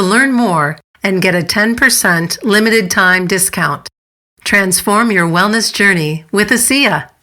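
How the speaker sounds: punchy announcer-style promo; ad read. Acted. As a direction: sell it like a polished podcast commercial. Reads a sponsor ad.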